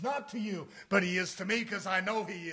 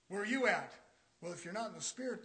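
usual speaking voice

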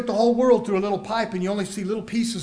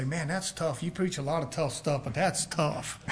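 soft, lower complaining aside; mock grumble; 5 semitones lower, 9 dB quieter than usual. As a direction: grumble it under your breath. Congregant muttering complaint.

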